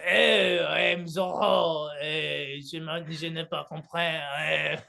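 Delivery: slow, hammed-up French accent as Zoro; bad French accent, half shouted; mock French accent. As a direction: parody a French swordsman's accent. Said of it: "You sound more like hybrid French".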